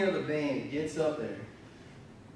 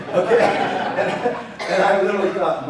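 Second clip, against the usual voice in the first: laughing; 4 semitones higher, 9 dB louder than usual. Real, not acted. Pastor laughing at the memory.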